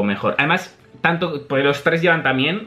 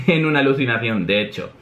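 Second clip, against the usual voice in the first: ordinary delivery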